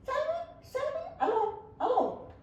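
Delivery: high, sing-song mock call of "Salvi"; wife calling out. Wife calling Dali away, laughing.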